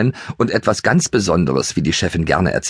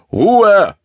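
bellowing; 13 semitones higher, 6 dB louder than usual. A narrator's reading. The driver bellows at the noisy ponies, loud and high. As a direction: shout back from the driver's cab. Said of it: "brüllte der Fahrer von vorne".

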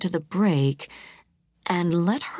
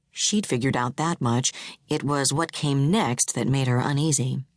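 usual speaking voice

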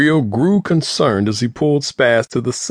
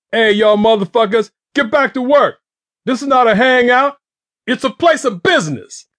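loud, much higher yell at his workers; 12 semitones higher, 4 dB louder than usual. A narrator's reading. Boss yelling at his dealers to get back to work. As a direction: bark orders across the corner. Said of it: "Rio yelled to his dealers".

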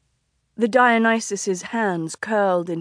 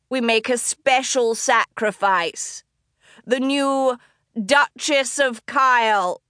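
theatrical announcement; 2 semitones higher, slower than usual. Acted. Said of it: "the Dionysus called".

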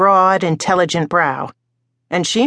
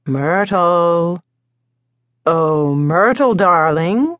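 sing-song; much slower than usual. Acted. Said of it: "His sing-song voice".

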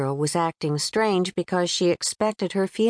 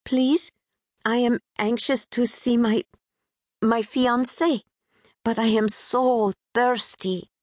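put-on a touch of Pakistani accent; 5 semitones higher than usual. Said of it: "a bit sing-song, infused with a British twang".

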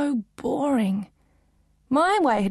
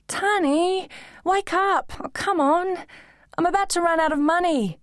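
put-on higher, bright teen girl; girlish Dino voice; 8 semitones higher than usual. A narrator's reading. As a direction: play the chatty young heroine.

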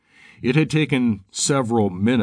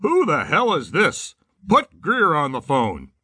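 high, indignant demand; 7 semitones higher than usual. A narrator's reading. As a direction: snap it out like an angry boss. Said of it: "he demanded".

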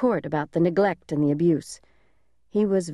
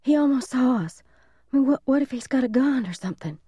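put-on high-pitched, anxious child's voice; frightened little boy; 7 semitones higher than usual. A narrator's reading. Little brother warning big brother of danger, high frightened voice. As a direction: fret high and urgently, stumbling over words.

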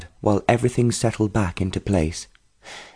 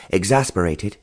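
ordinary delivery

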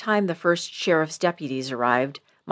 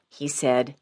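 usual speaking voice

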